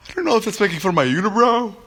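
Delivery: high, fast disbelief; incredulous. Real, not acted. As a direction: squeak it out in disbelief. Baffled reaction to comment.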